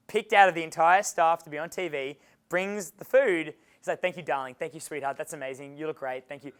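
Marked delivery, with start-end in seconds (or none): 4.0-6.5 soft, hushed sweet-talking chef; sweet Gordon Ramsay. Retold or imitated. Gordon Ramsay thanks the young waitress softly and sweetly. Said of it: "He's the sweetest, the sweetest".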